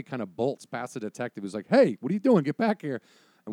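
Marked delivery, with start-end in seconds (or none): 1.7-3.0 high, loud "hey" and order; calling after someone. Acted. Detective calling the bolting kid back, shouting. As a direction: yell after a runaway.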